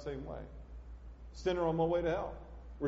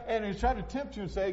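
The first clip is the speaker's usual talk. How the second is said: ordinary delivery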